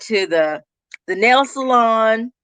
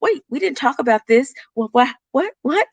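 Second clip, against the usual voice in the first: higher, stammering mock surprise; acted bafflement; 6 semitones higher than usual. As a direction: imitate someone caught off guard, tripping over the word. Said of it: "then they'll look like".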